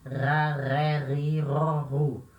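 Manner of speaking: 'Ra, ré, ri, ró, ru' with a drawn-out guttural R; every R in 'ra, ré, ri, ró, ru' made back in the throat. Shown as a demonstration. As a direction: slowly scrape each R of 'ra, ré, ri, ró, ru' in the throat. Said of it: "sempre o som da garganta".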